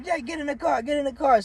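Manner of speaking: high, urgent order; father urging son into the car, high; imitating dad.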